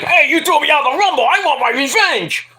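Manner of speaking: high, hammed-up indignant wrestler; mock outraged callout in a high voice; Charlotte Flair's revenge demand. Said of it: "Charlotte Flair's like".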